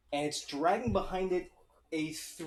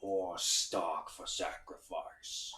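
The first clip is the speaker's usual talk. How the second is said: low, slow creature voice; menacing foe; 11 semitones lower, 7 dB quieter, much slower than usual. Acted. Game master voices a foe demanding sacrifice, low menace. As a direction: drawl it deep and drawn out.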